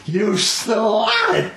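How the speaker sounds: high-pitched hammed-up scandalized cry; mock outrage. Teasing co-host over her saucy answer, mock outrage. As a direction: scold in pretend shock.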